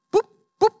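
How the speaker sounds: high, loud bouncy boop sound effect; cartoon boop noises. The sheep hopping back into the ditch, high cartoon boops. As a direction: pop each boop like a cartoon hop.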